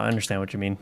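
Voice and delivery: usual speaking voice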